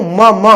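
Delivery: م repeated as short "ma, ma"; isolated letter sound. Shown as a demonstration. Teacher showing the tongue is unused, م repeated as "ma". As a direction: press the lips for each م. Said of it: "م کی آواز نکالنے میں زبان نہیں".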